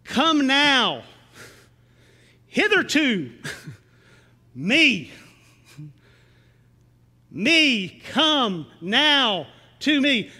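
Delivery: drawn out, each word weighed; slow emphatic translation. Shown as a demonstration. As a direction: lean into every word slowly. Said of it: "says this".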